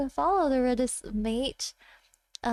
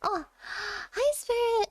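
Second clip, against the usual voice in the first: gasp, then a bright, higher "hi, Spirit!"; happy surprise; 6 semitones higher, 4 dB quieter than usual. Real, not acted. Streamer spots a long-missed viewer, delighted surprise.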